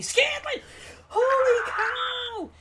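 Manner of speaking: high, loud screaming cry of joy; ecstatic shout. Real, not acted. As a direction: scream it like the game just flipped. Huge catch, shouting.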